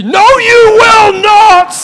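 high, loud shriek of "No, you will not!"; mock furious shout. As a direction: scream it like a possessive child, half-laughing. Acted kid refusing, screamed.

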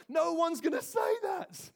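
shouted high and loud, slow; preaching shout. Real, not acted.

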